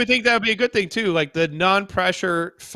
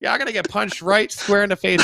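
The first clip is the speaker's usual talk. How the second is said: normal talking voice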